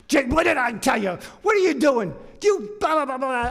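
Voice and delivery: high, hammed-up yelling; angry scolding shout. Toad catching him in the front row, angry scolding shout. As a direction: rant that runs off into gibberish.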